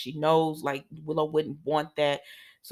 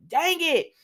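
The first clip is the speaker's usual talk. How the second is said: high, sharp cry of frustration; frustrated cry; 10 semitones higher, 3 dB louder than usual. Retold or imitated. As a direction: snap it out high, fed up. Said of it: "she starts like".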